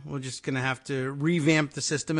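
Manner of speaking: ordinary talk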